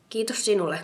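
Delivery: plain reading voice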